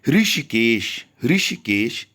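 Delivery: ऋषिकेश said loud and deliberate, ri then sh; careful pronunciation. Shown as a demonstration. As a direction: sound out ऋषिकेश with a clear ri. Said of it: "इस तरीके से उच्चारण भी आपका होना चाहिए".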